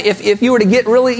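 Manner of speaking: ordinary talk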